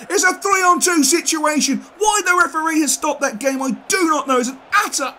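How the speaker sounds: loud, high outrage at the referee; furious rant. Real, not acted. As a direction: fan yelling at a bad whistle. Ranting at the referee's stoppage.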